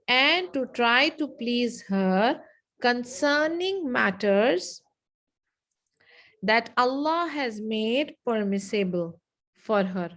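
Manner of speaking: slow, drawn-out dictation; slow repeat. Shown as a demonstration. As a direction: give it phrase by phrase, unhurried.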